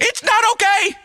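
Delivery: high, anguished shout; upset outburst. Acted. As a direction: cry out in protest, pitch shooting up. Rejecting the guru's soothing words.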